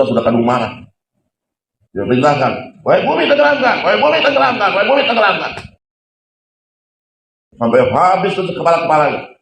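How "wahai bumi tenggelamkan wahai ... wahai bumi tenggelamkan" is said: much higher, relentless repeated order; angry command. Acted. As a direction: hammer the command three times. Musa's furious repeated command. (perintah marah berulang-ulang)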